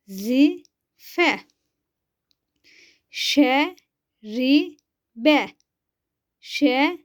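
each syllable said alone with a pause after it. Shown as a demonstration. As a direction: spell out the word slowly for pupils to repeat. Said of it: "Ben okuyorum".

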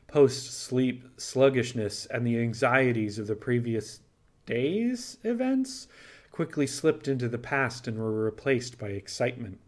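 plain reading voice

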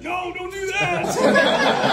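high, loud, slowly drawn-out laughing no; mock protest. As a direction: plead jokingly while laughing. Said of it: "And I said".

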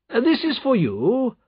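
high, slow condescending nobleman; patronising aristocrat, pitched high; haughty Marquis. A narrator's reading. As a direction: bestow it loftily, drawn out high. Haughty Marquis tosses a coin.

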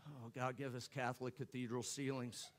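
mock prayer. Mock prayer for tall baby.

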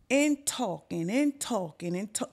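usual speaking voice

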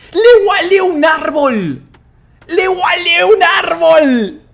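high, loud, slow shout stretching the words; incredulous shouting. Real, not acted. Streamer seeing a tree walled in, incredulous shouting. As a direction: bellow it in astonishment.

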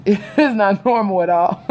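words through laughter; laughing. Real, not acted. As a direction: laugh while saying it.

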